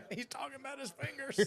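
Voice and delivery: high squeaky mocking tone; mock him in a silly high voice. Mocking the fingers line.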